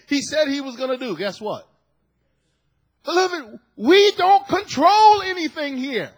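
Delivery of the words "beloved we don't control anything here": high, loud preaching call; preaching shout. Real, not acted. Pastor telling his people they control nothing, loud and high. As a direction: ring it out to the church. Preacher driving home point.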